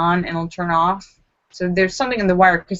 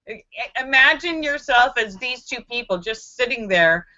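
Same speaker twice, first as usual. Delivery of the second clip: ordinary talk, nothing marked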